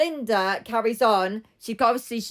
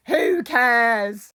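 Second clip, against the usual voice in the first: louder, mock drunken outburst; drunk Linda; 5 dB louder than usual. Drunk Linda cuts Elaine off, boozy and loud. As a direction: blurt it out like a tipsy heckler. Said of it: "drunk Linda is really funny".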